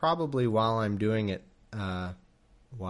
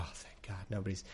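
much softer, relieved under-breath Abram; 5 semitones lower, 14 dB quieter than usual. Retold or imitated. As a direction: sigh it out in relief, very soft. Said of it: "he's like".

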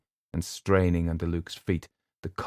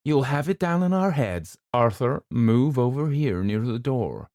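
raised voice, firm warning; bossy older woman; 6 semitones higher, 3 dB louder than usual. A narrator's reading. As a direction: order them about from the doorway. Mrs Montague warning from doorway.

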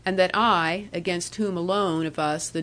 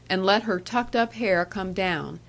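ordinary delivery

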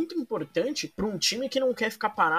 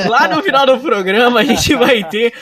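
João Kleber; 5 semitones higher, 9 dB louder than usual. Pretend. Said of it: "eu tô igual o João Kleber aqui".

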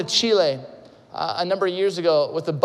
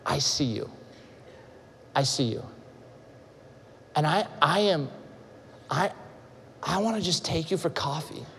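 tender reassurance; 4 semitones lower, 7 dB quieter than usual. Acted. Voiced tender invitation.

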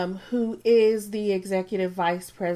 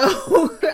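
bright giggle burst; high laugh; 9 semitones higher, 7 dB louder than usual. Real, not acted. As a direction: laugh at herself. Laughs at own drinking.

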